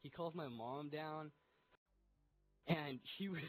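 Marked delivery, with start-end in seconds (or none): none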